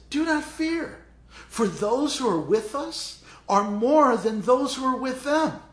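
high, loud, commanding declaration; bold proclamation. Acted. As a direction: proclaim it to the frightened servant. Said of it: "He says".

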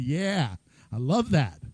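low, contented voice of God; low pleased God. Retold or imitated. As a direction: savour it like a proud father.

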